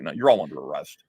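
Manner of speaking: lightly voiced jaded cop; weary cop deadpan. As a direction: say it like a tired cop who's seen it all. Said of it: "he's just like".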